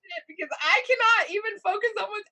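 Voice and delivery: high, loud, tearful panic outburst; hammed-up hysterical meltdown, voice shooting up. Wife melting down to her husband on the stairs, hysterical. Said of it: "I mean, I'm hysterical".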